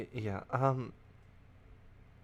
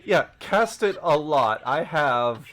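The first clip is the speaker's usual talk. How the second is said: loud, excited echo; 5 semitones higher, 9 dB louder than usual. Real, not acted. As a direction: cast it a lot with a shout. Echoes advice loudly laughing.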